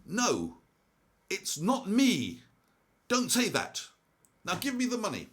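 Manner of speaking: the robber shouting down the cashier who named him; loud denial. Retold or imitated. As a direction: bark it back, caught out. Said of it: "glares at the cashier and says".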